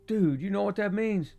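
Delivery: put-on young friend bursting with excitement; excited young man. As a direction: sell the big realization. Franklin excited.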